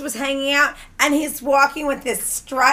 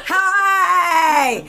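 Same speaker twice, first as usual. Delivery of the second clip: long, high drawn-out greeting; hammed-up singsong hello; flamboyant drunk man; 4 semitones higher than usual. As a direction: imitate a gushing party guy calling in. Acting drunk man calling at door.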